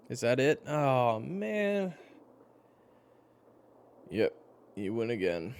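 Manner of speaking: high, loud groan of defeat; high-pitched, drawn-out dismay at a lost game; dismayed loser. Acted. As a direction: whine loudly about losing, pitch climbing. Loses the card game.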